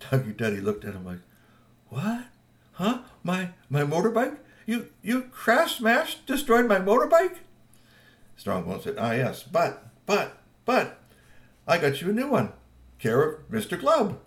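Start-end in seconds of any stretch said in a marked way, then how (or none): 1.9-7.4 high, slow, disbelieving cry; can't believe it's gone; shocked disbelief. Acted. Friend learning his motorbike was smashed, high and shocked. 9.0-14.2 higher, stammering, apologetic; sheepish. Acted. Strongbones sheepishly explaining the crashed bike, higher.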